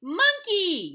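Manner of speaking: high, loud yell. A narrator's reading. Kitty boy yelling at Martians, high and loud. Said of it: "yelled Scapito".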